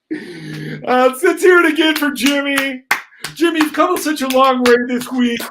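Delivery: high, loud emcee hype; big stage announcer energy. Acted. Headmaster announcing at talent show.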